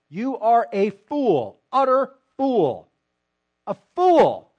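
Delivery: each "fool" hit slow, high and loud; emphatic fool. Acted. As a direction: hammer "fool" home, slowly. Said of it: "Did I emphasize that you're a fool?".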